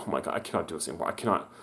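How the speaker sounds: soft, fast despairing mutter under the breath. Retold or imitated. Worker at breaking point muttering to herself, soft and fast. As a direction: mumble it like you're at the end of your rope. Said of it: "I was just like".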